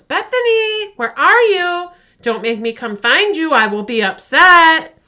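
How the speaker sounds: high, loud, growing angrier; demanding call. Retold or imitated. The fake mother's voice demands Bethany come out, loud and threatening. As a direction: call out with rising menace. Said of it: "the voice gets more demanding".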